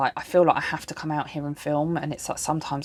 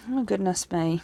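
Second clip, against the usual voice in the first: ordinary talk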